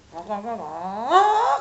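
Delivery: slow, loud engine sputter noise; mock engine noise, slow and loud; two-stroke engine imitation. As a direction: make a weak two-stroke engine sound.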